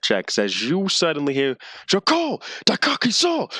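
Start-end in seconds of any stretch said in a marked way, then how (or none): 1.9-3.5 hobgoblin war cry. Acted. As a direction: yell it like a goblin soldier. Goblin war cry from the street.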